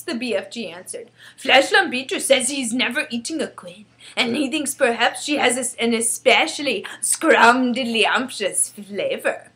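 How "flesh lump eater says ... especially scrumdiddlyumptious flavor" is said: loud, relishing giant voice; emphatic giant passing on gossip; chatty friendly giant. Acted. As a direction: report it as a simple-spoken giant, savouring odd words. BFG reports giant's appetite.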